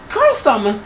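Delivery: high, loud exclamation; shocked cry. A narrator's reading. Triax to Carl in the story, shocked high cry. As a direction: cry out the name high, scandalized.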